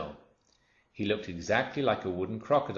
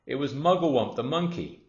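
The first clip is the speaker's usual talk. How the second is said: louder, higher announcing the monkey; excited reveal; 6 semitones higher, 5 dB louder than usual. A narrator's reading. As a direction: narrator bursting with the surprise.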